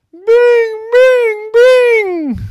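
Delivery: piercing high, loud chimes; screamed piano notes. Acted. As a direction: shriek the notes like hammered top keys. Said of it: "Peyton screamed from the room".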